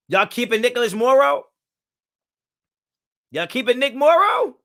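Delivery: loud, high-pitched disbelief; incredulous question. Real, not acted. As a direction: challenge the audience, voice shooting up. Disbelief at the chat's choice.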